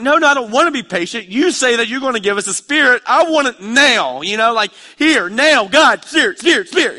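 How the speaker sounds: put-on high, loud, childish insistence; loud whiny pleading for the Spirit; impatient demand. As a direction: demand it now like a kid. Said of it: "I feel like my little four-year-old".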